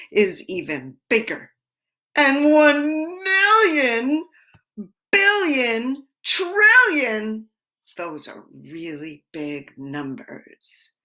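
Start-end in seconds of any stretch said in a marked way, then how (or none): 2.2-7.4 growing excitement. Acted. Teacher building excitement about numbers.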